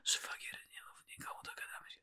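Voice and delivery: very soft mock-secretive whisper; conspiratorial whisper. Woman secretly bargaining with her brother-in-law, hushed whisper. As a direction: whisper it like a shady deal.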